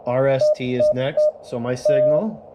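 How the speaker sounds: usual speaking voice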